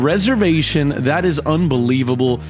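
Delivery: normal talking voice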